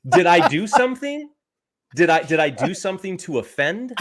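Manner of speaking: high, loud, laughing disbelief; laughing mock outrage. Real, not acted. Husband laughing back at his wife's crack about fat. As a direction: act offended but keep cracking up.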